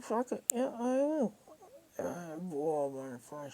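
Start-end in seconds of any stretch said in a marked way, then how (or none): 0.0-1.3 much higher, much louder, fired-up yes; defiant yell. Retold or imitated. Agreeing to ring the cops on him, a defiant shout. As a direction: shout it like you've finally snapped. Egged on to call police.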